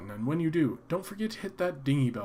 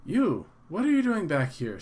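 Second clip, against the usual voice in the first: higher, louder accusing clerk; 6 semitones higher, 7 dB louder than usual. Acted. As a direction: confront him like a startled shopkeeper. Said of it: "says the clerk".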